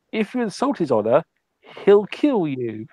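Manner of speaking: normal talking voice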